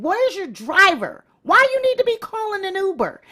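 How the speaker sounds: loud, high-pitched incredulous questions; exasperated scolding. Real, not acted. Scolding the absent celebrity.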